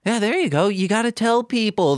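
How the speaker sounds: ordinary talk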